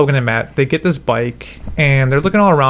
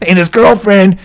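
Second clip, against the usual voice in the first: smoker's rasp; 8 semitones higher than usual. Retold or imitated. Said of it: "he talked just like that old-ass ninety-two-year-old man".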